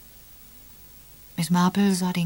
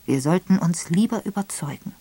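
ordinary delivery

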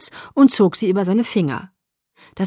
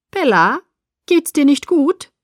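higher-pitched, worried teacher; concerned; 6 semitones higher, 4 dB louder than usual. A narrator's reading. Teacher checking on a faint student, concerned. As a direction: ask with anxious concern. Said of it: "klang besorgt".

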